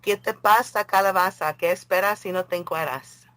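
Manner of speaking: reciting a silly Spanish rhyme. Shown as a demonstration. Reciting a Spanish joke rhyme to a friend, playful. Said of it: "That means".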